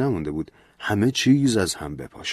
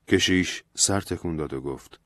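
plain reading voice

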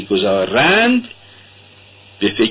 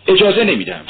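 shouted refusal; 8 semitones higher than usual. A narrator's reading. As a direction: hurl the refusal back at him. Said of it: "راسکولنیکوف تکرار کرد". (فریاد تکراری و سرسختانه)

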